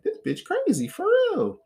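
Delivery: high, disbelieving aside; incredulous. Retold or imitated. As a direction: pitch it way up in disbelief. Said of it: "I'm sitting there just like".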